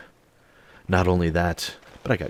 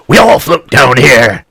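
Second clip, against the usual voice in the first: a sudden roar, much louder and higher; jump-scare Pennywise line, bellowed inches away; creepy clown voice; 7 semitones higher, 17 dB louder than usual. Acted. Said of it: "she hears a voice".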